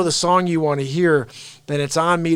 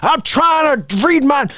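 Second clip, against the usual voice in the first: mock yelling old man; 7 semitones higher, 5 dB louder than usual. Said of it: "He's gonna start yelling at people".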